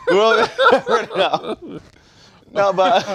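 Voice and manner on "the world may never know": slogan broken by a laugh, higher and slower; giggling commercial tagline; laughing through it. Pretend. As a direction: laugh out the ad line.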